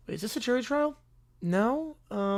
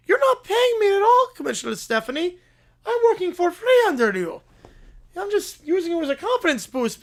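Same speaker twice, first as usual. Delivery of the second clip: indignant protest; 8 semitones higher, 3 dB louder than usual. Acted. Doctor protests to commissioner.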